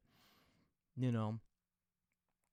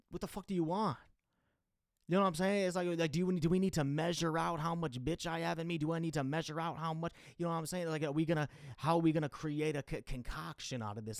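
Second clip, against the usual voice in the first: louder, higher, fed-up ranting; exasperated; 5 semitones higher, 4 dB louder than usual. Real, not acted. Venting about what girls want, exasperated and louder. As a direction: throw up your hands in disbelief.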